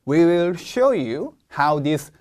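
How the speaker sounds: slow, deliberate English reading; slow model reading. Shown as a demonstration. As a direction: stretch each word out for the learners. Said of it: "그래서 보면". (천천히 끊어 읽는 시범)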